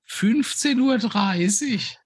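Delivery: high, slow sweet housewife; wife voice. Acted. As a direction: chirp it like a doting wife. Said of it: "du bist meine Frau".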